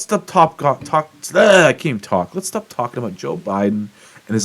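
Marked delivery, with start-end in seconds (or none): none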